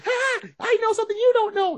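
put-on high singsong childish taunt; mock bratty gloating; taunting sidekick. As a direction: tease in a high-pitched kid voice. Robin taunting, poking the bear.

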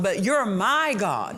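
"my" stretched and punched in "my God"; personal stress on MY. Shown as a demonstration. Preacher models thanking God as his own, stressing "my" with conviction. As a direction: claim it as yours, landing hard on "my". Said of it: "you take personal ownership".

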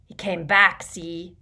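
put-on dim drunkard, loud then dropping; stupidly. A narrator's reading. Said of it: "he added stupidly".

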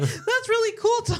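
high, laughing fake excitement; mock enthusiasm. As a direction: gush over it while laughing. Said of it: "I was like".

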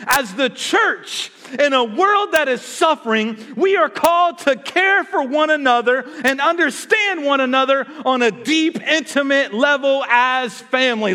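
high, loud, impassioned sermon delivery; full-force preaching. Real, not acted. Preacher urging the church to care, full-force preaching. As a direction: stretch the key words and drive them at the congregation.

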